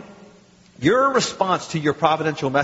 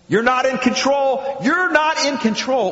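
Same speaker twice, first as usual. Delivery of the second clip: higher, slower, pressing each word; insistent rising warning; 9 semitones higher, 3 dB louder, slower than usual. Acted. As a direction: hammer it home like a stern messenger. Messengers insisting, repeated louder.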